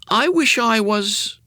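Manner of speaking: put-on high, slow boyish start; boy Jimmy wishing. A narrator's reading. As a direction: start the wish slowly like a boy. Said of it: "he began".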